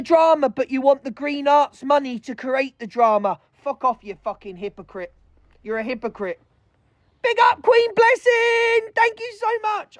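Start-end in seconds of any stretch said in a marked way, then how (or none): 3.5-6.4 contemptuous insult. Real, not acted. Contemptuous insult after rant. 7.2-10.0 cheery shout-out. Real, not acted. Thanking a gifter on live.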